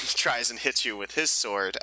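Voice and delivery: usual speaking voice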